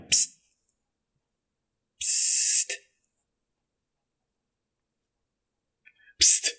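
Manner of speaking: hissed, voiceless psst; furtive psst. Acted. Ruffian in the tree line signals Ruby, furtive hiss. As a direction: get her attention with a sharp hiss.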